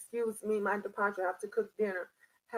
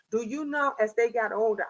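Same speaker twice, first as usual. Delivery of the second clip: ordinary talk, nothing marked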